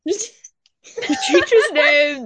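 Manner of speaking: suddenly realizing she said the name; alarmed realization. Real, not acted. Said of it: "We'll cut that out".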